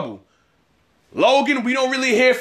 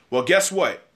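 ordinary delivery

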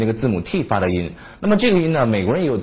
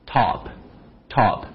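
American top. Shown as a demonstration. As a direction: top with a wide open ah, slowly. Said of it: "美国人可能嘴巴稍微大一点".

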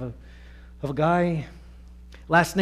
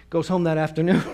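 ordinary delivery

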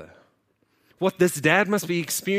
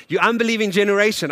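rebuke; 5 semitones higher, 6 dB louder, faster than usual. Acted. Repeat of rebuke.

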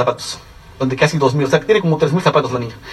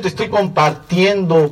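slow, deliberate; much slower than usual. Real, not acted. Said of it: "no te estoy presumiendo".

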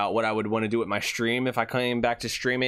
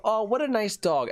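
high-pitched sweet dog praise; hammed-up cooing; 11 semitones higher than usual. Mailman petting a lady's dog, hammed-up cooing. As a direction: coo over the dog, overdone.

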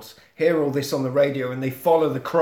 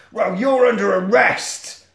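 loud, slow, raised proclamation; forceful arrest; 6 semitones higher, 5 dB louder than usual. Acted. Cop arresting the vigilante, loud drawn-out declaration.

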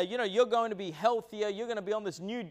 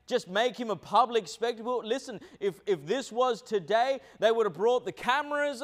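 normal talking voice

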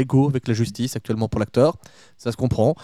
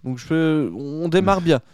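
ordinary delivery

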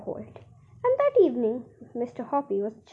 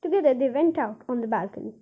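plain reading voice

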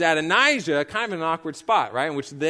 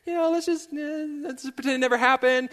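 very high, mock nervous squeak with a laugh; Adonijah's terrified pleading; 11 semitones higher than usual. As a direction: plead awkwardly in a put-on high voice. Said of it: "Adonijah himself is also terrified".

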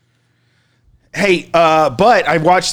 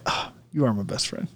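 quiet sarcasm; 5 semitones lower, 13 dB quieter than usual. Retold or imitated. Sarcastic aside.

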